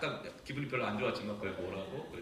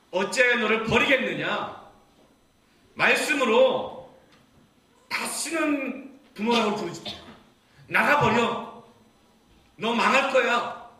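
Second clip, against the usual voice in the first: loud, high, slow furious scolding; God's fiery rebuke; 8 semitones higher, 10 dB louder, slower than usual. Acted. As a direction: thunder it as an angry father. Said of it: "이렇게 책망하시고 불같이 화내시고". (불같이 화내며 책망하는 하나님)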